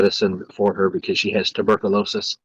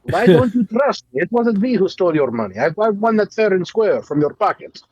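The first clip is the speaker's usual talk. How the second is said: mock Russian accent; 9 semitones higher than usual. Teasing friends in a bit about Soviet Russia, hammy Russian accent. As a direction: lecture a comrade about sharing, in broken Russian English.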